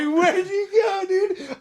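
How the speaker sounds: very high, drawn-out question through laughter; laughing disbelief. Retold or imitated. Asking a friend who just disappeared, laughing in disbelief. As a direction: squeal it slowly while cracking up.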